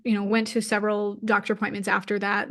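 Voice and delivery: normal talking voice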